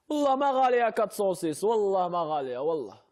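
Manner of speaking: loud high protest; insistent protest. Real, not acted. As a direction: swear it's cheap, loudly.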